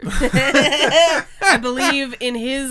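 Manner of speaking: loud, high, amused delivery; laughing. Real, not acted. Co-host topping a meatball joke, laughing. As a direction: push the joke out through laughter.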